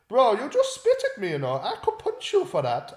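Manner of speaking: imitation of an offended challenger; mock opponent. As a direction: put on the other lad's indignant voice. Retold threat from opponent.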